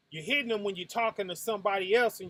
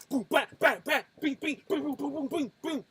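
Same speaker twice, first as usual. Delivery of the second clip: punch sounds; 7 semitones higher, faster than usual. Retold or imitated. Miming a boxing workout.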